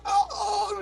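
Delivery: very high, drawn-out fake "oh"; mock cry of alarm. Grandpa pretends his thumb comes off, high-pitched mock cry. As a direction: squeal as if your thumb came off.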